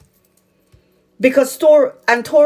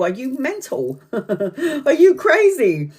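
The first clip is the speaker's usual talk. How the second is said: laughing disbelief; 4 semitones higher, slower than usual. Real, not acted. Mocking disbelief at not crying.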